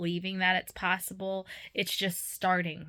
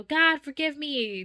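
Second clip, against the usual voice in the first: slow, high, louder plea for forgiveness; pleading cry to God; 8 semitones higher, 7 dB louder, slower than usual. Retold or imitated. Dreamer voicing a repentant cry to God, slow and high. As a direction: cry out for mercy, drawn out. What trapped people should cry.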